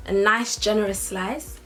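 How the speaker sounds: usual speaking voice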